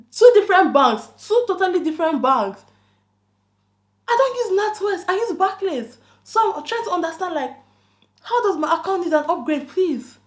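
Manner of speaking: exasperated. Real, not acted. Exasperated rant about the scam.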